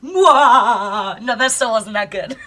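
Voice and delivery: giggling through the words; real giggles, breaking up laughing; laughing at herself. Real, not acted. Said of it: "wasn't even a good evil laugh".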